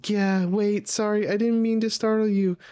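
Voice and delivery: very high, urgent character voice; startled apology. Acted. Nami apologizes and calls for Usopp, acted high-pitched alarm. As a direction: blurt it high, then call out for a friend.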